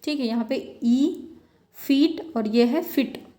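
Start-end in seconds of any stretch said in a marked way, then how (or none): 1.7-3.3 "फीट" stretched long, then "फिट" clipped short; long vs short vowel. Shown as a demonstration. Teacher contrasting फीट and फिट for students. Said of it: "ये long वावेल ... ये short वावेल".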